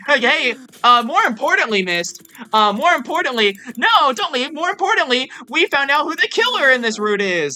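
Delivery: high, loud, insistent calling; urgent pleading. Real, not acted. Streamer begs Mist to stay for killer reveal, urgent. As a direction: plead loudly to hold their attention.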